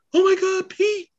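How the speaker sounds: very high, loud falsetto cry of discovery; mock shocked squeal. Friend acts out finding the hero's secret by accident, mock shocked squeal.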